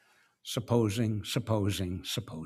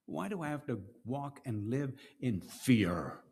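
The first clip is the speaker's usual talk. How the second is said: ordinary talk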